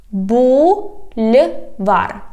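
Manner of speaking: "Бульвар" slow, the soft L set apart. Shown as a demonstration. Teacher showing the soft sign, "Бульвар" with soft L apart. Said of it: "we have here soft L".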